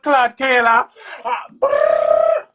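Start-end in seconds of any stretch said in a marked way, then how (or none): 1.2-2.5 sudden high rolled brrr; high hype trill. Real, not acted. Hype call mid shout-out.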